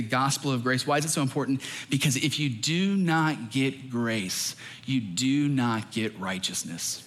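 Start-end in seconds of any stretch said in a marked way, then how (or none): 1.9-7.0 slow emphatic point. Acted. Slowed emphatic preaching.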